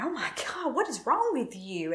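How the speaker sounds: retold freak-out, pitched high; high-pitched dismay without raising the volume. Wife after the glass cut her leg, freaking out. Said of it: "freaking out at this point".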